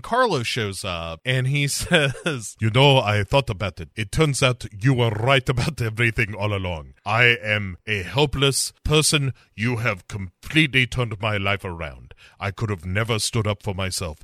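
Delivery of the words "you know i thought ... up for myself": low mock-humble Latin accent. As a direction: confess gratefully in a comic Latin accent. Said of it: "my sexy Latin accent".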